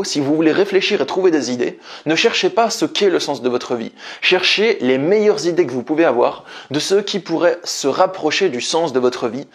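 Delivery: normal talking voice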